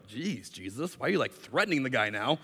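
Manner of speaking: low, soft mock protest; understated, uneasy questioning; taken aback. Preacher reacts to Jesus' warning, taken aback.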